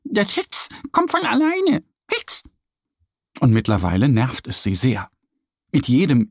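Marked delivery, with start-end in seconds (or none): 0.1-2.4 put-on much higher, childlike duck voice ending on a hiccup; little duck voice. A narrator's reading. Duck Annie explaining to Mo, higher duck voice with a hiccup. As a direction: play the small duck, then hiccup.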